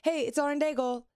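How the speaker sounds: high radio liner hello; put-on high radio greeting; going up. Shown as a demonstration. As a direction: lift the pitch for a station ID. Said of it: "why do I always go up".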